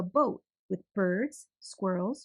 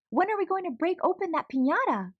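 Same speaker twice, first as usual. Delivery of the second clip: put-on eager child; 8 semitones higher, 3 dB louder than usual. Eager child asks aunt.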